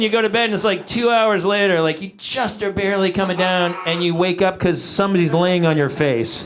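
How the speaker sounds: usual speaking voice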